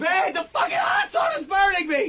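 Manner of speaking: high strained shouts, dragged out; yelling in pain. Acted. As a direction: shout through the burning. Burning, arguing in pain.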